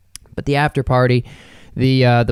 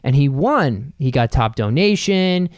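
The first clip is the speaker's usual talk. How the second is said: ordinary delivery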